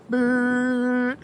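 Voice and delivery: put-on a short high beep like an old phone; dial tone beep imitation.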